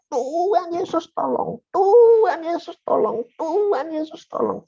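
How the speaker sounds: high, slow pleading cry to Jesus; pained crying out. Retold or imitated. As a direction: call out to Jesus in pain. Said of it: "saya teriak". (teriakan minta tolong bernada tinggi menahan sakit)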